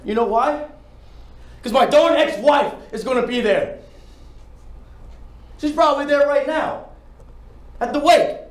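high, loud desperate yelling; anguished shouting. Acted. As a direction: shout out the confession in despair. Audition monologue, shouted confession.

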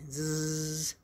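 low, soft buzzing snore; a drawn-out sleepy "zzz"; imitated snoring. A narrator's reading. Badger dozes off while refusing Groundhog, mock snoring.

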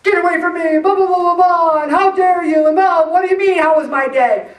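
loud, high mock rant; hammed-up outburst at the husband; snapping at husband. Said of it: "And I'm like".

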